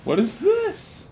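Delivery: high, slow disbelieving question; shocked at what he hears; surprised. Real, not acted. Reacting to own sleep talk.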